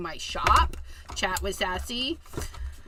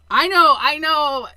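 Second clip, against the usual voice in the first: loud protest; 4 semitones higher, 12 dB louder than usual. Real, not acted.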